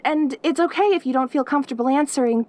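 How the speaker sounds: ordinary delivery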